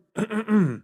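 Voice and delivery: pointed awkward throat clear. Real, not acted. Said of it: "Crazy time to have to clear the throat".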